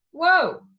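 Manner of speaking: loud, high startled whoa; surprised whoa. Real, not acted. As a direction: let it burst out in real surprise. Reacting to the Chopra quote.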